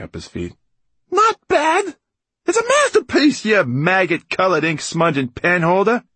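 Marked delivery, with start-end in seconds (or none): 1.1-2.0 very high, loud, incredulous echo; indignant outburst. A narrator's reading. Writer insulted by faint praise, indignant shriek. Writer outraged, echoing the verdict. 2.4-6.0 high, loud, outraged insult; furious outburst. A narrator's reading. Writer defends his song, outraged shouting. Writer rages at glass man.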